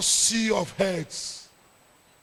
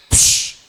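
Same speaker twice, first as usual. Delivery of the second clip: loud imitated whoosh; sharp burst of hushing air; whooshing noise; 8 dB louder than usual. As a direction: make a forceful "psh" sound effect. Mimics everything selling out.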